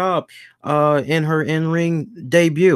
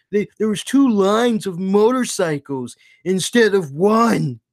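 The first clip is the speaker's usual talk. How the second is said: indignant hardcore fan; 5 semitones higher than usual. Retold or imitated. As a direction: argue back like an offended superfan. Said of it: "they're gonna be like".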